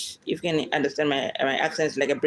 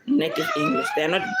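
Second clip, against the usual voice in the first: much higher, a sudden laughing squeal; laughing outburst; faster than usual. Real, not acted. Vlogger correcting herself about native English, laughing high. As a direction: let the laugh burst out on it.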